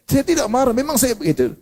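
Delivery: high-pitched indignant retort; mock angry denial. Quick-tempered person answering the ustaz, high heated protest. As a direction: protest hotly, as if you weren't angry. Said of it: "jangan marah-marah".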